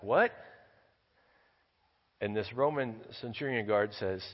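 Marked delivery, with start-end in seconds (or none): 0.0-0.3 short loud startled shout, rising; shouting back. Retold or imitated. Rabbi shouts back at the guard, startled. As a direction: yell it up at the wall. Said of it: "he shouts back".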